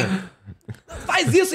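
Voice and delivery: slow, strangled mock cry; voice won't come out. Frightened passenger trying to stop the driver, voice choked off. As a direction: imitate a yell stuck in the throat. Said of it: "a voz não sai".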